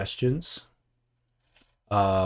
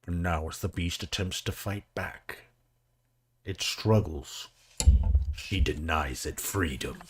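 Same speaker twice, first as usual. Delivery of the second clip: hushed, low play-by-play of a struggle; mock narration; 4 semitones lower than usual. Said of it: "You said narrate".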